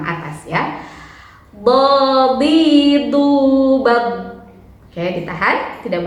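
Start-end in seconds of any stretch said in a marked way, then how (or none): 1.6-4.3 bo, bi, du, bab called out loud and high; loud teacher drilling syllables; loud drill recital. Shown as a demonstration. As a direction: chant each syllable firmly for the class. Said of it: "kita latihan ya".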